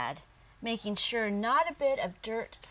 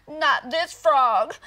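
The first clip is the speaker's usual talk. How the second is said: slow, much louder tearful cry; crying frog; 5 semitones higher, 11 dB louder, slower than usual. Acted. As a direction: cry it out, dragging each word. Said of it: "little frog cried".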